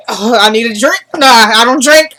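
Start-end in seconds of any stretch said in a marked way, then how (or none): none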